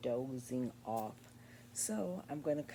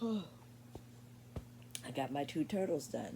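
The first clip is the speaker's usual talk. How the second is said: weary exhale into slow speech; sleepy crafter tells viewers her progress, sighing; tired sigh then slow words; slower than usual. Real, not acted. Long tired sigh, slow.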